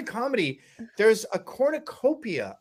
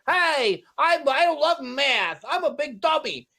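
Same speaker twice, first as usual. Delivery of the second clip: put-on higher, slow nasally New York accent; mock Steve Martin doing Roger Stone in nasally New York accent; 7 semitones higher, slower than usual. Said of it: "That's a nasally New York accent".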